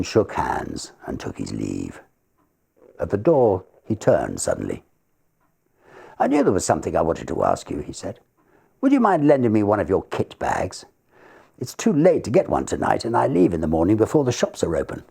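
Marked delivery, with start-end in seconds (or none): 6.2-15.0 higher, fast eager request; lightly voiced young man; young secretary asking. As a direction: ask a small favour brightly. Secretary asks favour.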